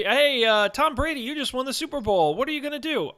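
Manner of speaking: high-pitched pretend post-game question; mock sideline interviewer. Host plays reporter quizzing the Super Bowl winner, mock excited. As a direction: put it to the champion in a high voice.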